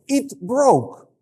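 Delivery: put-on high, loud little-kid voice; small child reporting. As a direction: announce it like a child running to daddy. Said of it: "they start speaking like this".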